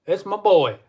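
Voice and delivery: loud, high, proud call-out; excited greeting. Real, not acted. Streamer greets his friend Jason, excited call-out. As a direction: hail your buddy with a big grin.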